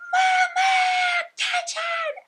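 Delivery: loud, very high drawn-out scream, imitating a little girl; child's full-breath yell. Frustrated little girl yells to her mom, piercing shout. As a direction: scream it at the top of your lungs. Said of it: "biggest deep breath that she possibly could and goes".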